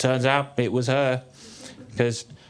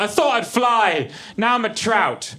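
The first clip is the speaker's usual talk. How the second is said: higher, slow lament; mock dismay; 8 semitones higher, 3 dB louder, slower than usual. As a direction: wail it in slow mock despair. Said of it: "I was like".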